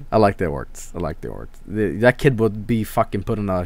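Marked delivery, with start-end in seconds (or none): 0.9-1.5 softer and lower, half to himself; quiet echo. Real, not acted. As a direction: repeat it under your breath. Repeats the line as echo.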